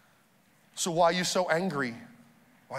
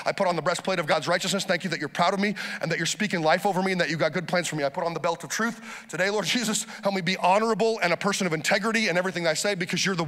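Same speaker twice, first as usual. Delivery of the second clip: rattled-off prayer, quick and practiced; a pastor speeding through his morning armor prayer; fast prayer. Shown as a demonstration. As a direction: pray it fast like a daily habit. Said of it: "See how fast I just prayed that?".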